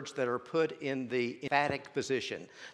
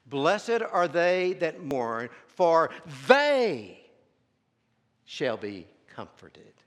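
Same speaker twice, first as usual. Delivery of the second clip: they punched loud and drawn out, then a pause; stress on they; 4 semitones higher, 8 dB louder, much slower than usual. Shown as a demonstration. Preacher reading the beatitude to show the emphatic word, stress on "they". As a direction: read it slowly, landing hard on "they". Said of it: "you read it this way".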